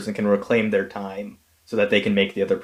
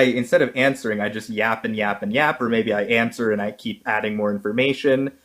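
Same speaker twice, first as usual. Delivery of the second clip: ordinary talk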